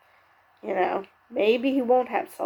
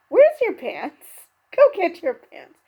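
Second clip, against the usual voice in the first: higher, louder imitation of a scolding; lightly acted telling-off; mock scolding; 7 semitones higher, 5 dB louder than usual. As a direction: retell it as an exasperated order. Retelling staffer scolding pantsless contestant.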